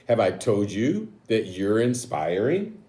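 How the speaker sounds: storybook read-aloud, slow and drawn out; reading to children. Acted. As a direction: read the next page to the kids, savouring it. Reads picture book aloud.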